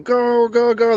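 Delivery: high-pitched cheering at the track; Mush. Retold or imitated. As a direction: urge the horse on, acted out. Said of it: "Mush is going".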